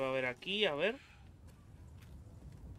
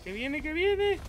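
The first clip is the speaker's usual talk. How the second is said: much higher, louder panic; panicked cry; 12 semitones higher, 5 dB louder, slower than usual. Real, not acted. Player fleeing the approaching monster, panicked high cry. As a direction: squeal that it's coming.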